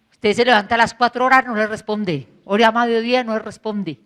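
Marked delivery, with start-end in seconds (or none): none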